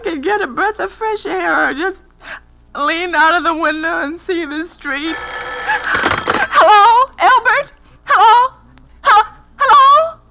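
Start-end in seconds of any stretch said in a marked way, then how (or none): 5.2-10.2 high, loud, near-sobbing calls; a desperate wife calling into a silent phone; frantic hello. Acted. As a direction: shout down the line, panicking.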